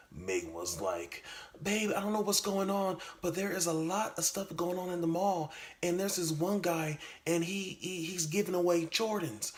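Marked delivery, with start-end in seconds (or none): 1.6-9.5 put-on much higher, sweet girlfriend voice. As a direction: answer him like a sweet girlfriend.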